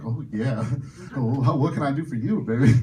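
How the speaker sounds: low-pitched, flirty come-on; mock seductive. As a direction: play the smooth ladies' man. Acts out his flirty reply.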